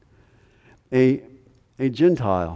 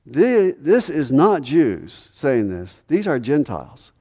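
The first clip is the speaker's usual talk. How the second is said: higher and louder, fiery sermon reading; preaching at full force; 5 semitones higher, 4 dB louder than usual. Acted. Preacher reads Matthew to the congregation, preaching at full force. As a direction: proclaim it to the back row.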